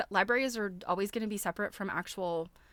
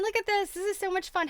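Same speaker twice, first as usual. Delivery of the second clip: delighted excitement; 12 semitones higher, 5 dB louder than usual. Real, not acted. Delighted by an old craft book.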